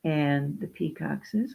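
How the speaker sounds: ordinary delivery, nothing marked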